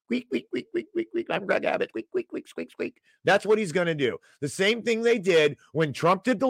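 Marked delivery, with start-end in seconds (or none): 0.1-1.3 high, tiny mouse squeaking; squeaky cartoon mouse noises, much higher; mouse squeaks. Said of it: "and run away squeaking". 1.3-3.0 a lightly voiced, higher little mouse that fades to a whisper; mock the governor as a timid squeaky mouse; meek mouse version of the governor, dropping to whispered squeaks; Greg Abbott as a mouse. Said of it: "he's gonna turn into a normal mouse".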